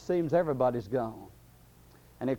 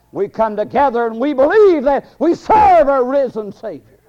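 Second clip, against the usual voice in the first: raised, loud sermon voice; full-force preaching; 6 semitones higher, 9 dB louder than usual. Real, not acted. Preacher proclaiming the risen Savior to the congregation, full-force preaching. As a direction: proclaim it high and strong to the church.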